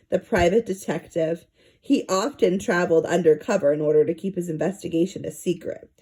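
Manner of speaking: plain reading voice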